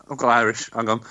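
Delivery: usual speaking voice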